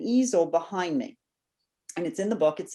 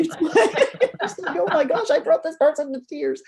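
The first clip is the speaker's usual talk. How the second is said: laughing through the words, pitched high; 9 semitones higher, 4 dB louder than usual. Real, not acted. Author recalling a crying fan, laughing and high. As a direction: giggle at the thought of making her cry.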